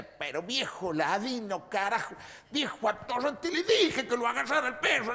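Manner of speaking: high, indignant outburst climbing in pitch; fuming. Acted. Bitten cousin Soledad berating the dog's owner, fuming. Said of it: "se levanta hecho una brasa Soledad y dice".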